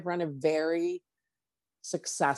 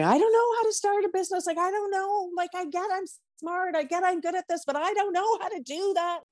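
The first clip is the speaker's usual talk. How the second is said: much higher, fast, whining voice; whiny protest. Retold or imitated. Arguing with her inner voice, she whines way up high. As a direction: whine it up high like a scared kid. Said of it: "having an argument with myself... and going".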